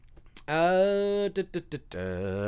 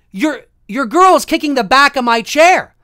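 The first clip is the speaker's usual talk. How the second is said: loud, raised-pitch indignant complaint; angry confrontation; 5 semitones higher, 9 dB louder than usual. Retold or imitated. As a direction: retell it as a heated accusation. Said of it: "I was so angry".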